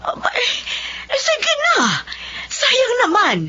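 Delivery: mother hearing her daughter can study again, crying with joy; tearful joy. Acted. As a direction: burst out in tearful relief. Mother overjoyed at scholarship news.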